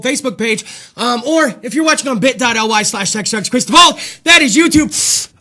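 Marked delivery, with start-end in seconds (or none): none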